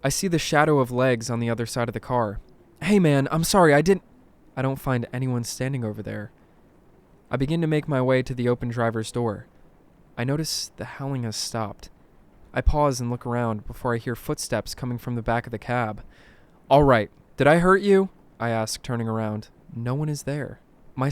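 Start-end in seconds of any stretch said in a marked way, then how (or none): 2.8-4.0 louder, higher, urgent apology cut short; flustered apology. A narrator's reading. Driver apologises to legs behind the car, flustered. 16.7-18.1 loud, raised-pitch question; anxious call. A narrator's reading. Driver calls toward footsteps behind the cab, anxious.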